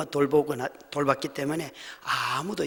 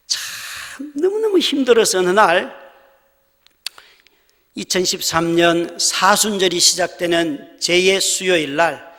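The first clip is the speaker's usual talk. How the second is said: drawn out, louder, building to tears; slow, loud preaching; 8 dB louder than usual. Real, not acted. Preacher recalls his despair, slow and loud. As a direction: lean on each word slowly.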